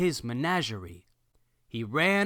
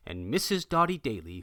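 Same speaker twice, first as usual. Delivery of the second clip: ordinary delivery, nothing marked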